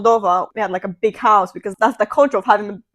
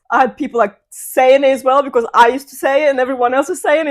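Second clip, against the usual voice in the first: ordinary talk, nothing marked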